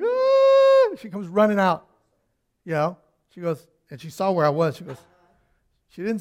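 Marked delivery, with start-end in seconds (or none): 0.0-1.0 very high yelp of pain; imitating his childhood scream; retold scream. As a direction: scream like a hurt kid. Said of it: "yelling, screaming".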